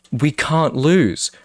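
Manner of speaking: slow, swelling mock-triumphant boast; hyped boast. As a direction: stretch it out like a triumphant rallying cry. Hyping up a streaming duo.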